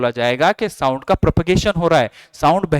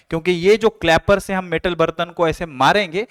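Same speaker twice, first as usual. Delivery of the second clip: ordinary talk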